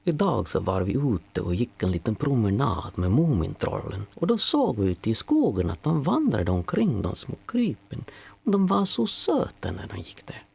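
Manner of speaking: adorable Finnish accent on Swedish words. Shown as a demonstration. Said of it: "when you talk with a Finnish accent".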